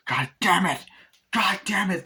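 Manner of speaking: high, loud mock cursing; angry outburst. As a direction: curse in acted exasperation. Acted outburst as ritual goes wrong.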